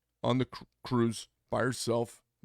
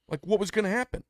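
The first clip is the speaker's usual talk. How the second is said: higher, louder, incredulous jab; exasperated challenge; 9 semitones higher, 5 dB louder than usual. Retold or imitated. To a friend who blames others, exasperated. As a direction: press her on what she expected. Berating friend in retold talk.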